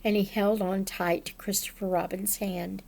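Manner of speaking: usual speaking voice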